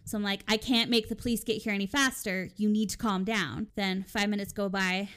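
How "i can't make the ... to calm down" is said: normal talking voice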